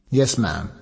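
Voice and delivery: plain reading voice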